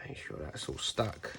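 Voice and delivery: soft unvoiced muttered check; whispered aside. Real, not acted. Crafter concentrating on the tape, whispered to self. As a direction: whisper it to yourself while working. Focused quiet aside while working.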